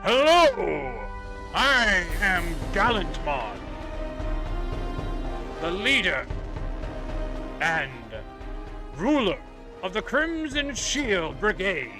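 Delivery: stern royal voice, loud and slow; booming warrior king. Acted. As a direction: proclaim like a noble knight leader. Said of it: "very kind of stern royal voice".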